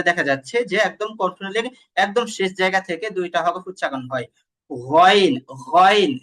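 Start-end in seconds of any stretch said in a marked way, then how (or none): 4.7-6.2 "গইন" louder, gargled from back of throat; ghain demo. Shown as a demonstration. Teacher shows "this is how", emphatic articulation of ghain. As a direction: push "গইন" firmly from the throat's end. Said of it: "এই যে এইভাবে".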